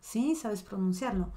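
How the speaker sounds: usual speaking voice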